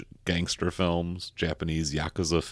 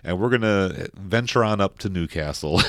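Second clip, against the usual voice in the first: usual speaking voice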